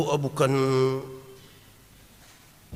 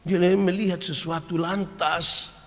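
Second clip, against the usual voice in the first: ordinary talk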